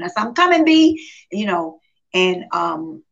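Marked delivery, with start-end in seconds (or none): 0.2-1.1 higher, louder retold promise; emphatic reassurance. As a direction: call back an insistent promise to a friend. Retelling her answer to friend's question.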